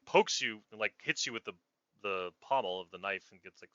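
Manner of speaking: ordinary talk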